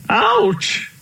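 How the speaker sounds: high yelp of pain; sudden high-pitched ouch; pained cry. A narrator's reading. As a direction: cry out as if something aches. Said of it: "cried Uncle Elephant".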